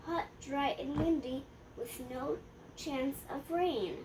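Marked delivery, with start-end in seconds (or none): none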